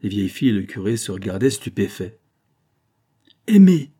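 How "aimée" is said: high, loud outraged cry of the name. A narrator's reading. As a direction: shout the name in shock. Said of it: "s'écria enfin Victoire". (cri outré, aigu et fort)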